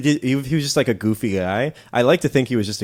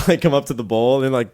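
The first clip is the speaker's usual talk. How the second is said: normal talking voice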